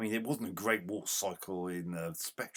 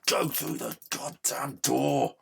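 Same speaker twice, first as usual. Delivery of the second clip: higher, louder and slow; angry shout; 6 semitones higher, 7 dB louder, slower than usual. Real, not acted. Gamer yelling at his character at the door again, angry shout. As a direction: drag the words out in fury.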